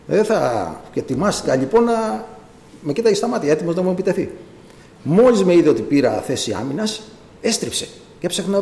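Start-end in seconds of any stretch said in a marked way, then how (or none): none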